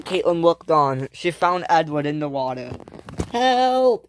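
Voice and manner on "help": high acted distress cry; raised, wailing call; screamed for help. A narrator's reading. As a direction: cry out from the water, pitched way up. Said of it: "he screamed".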